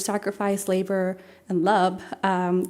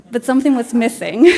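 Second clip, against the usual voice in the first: laughing; 3 semitones higher, 10 dB louder than usual. Real, not acted. Laughs at own remark.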